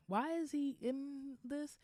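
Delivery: high, slow puzzled question; drawn-out disbelief; baffled. Retold or imitated. Wondering why Louis was cast.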